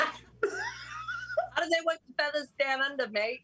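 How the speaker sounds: put-on high, slow Australian accent. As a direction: put on a drawn-out Aussie accent. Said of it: "give us Australian accents".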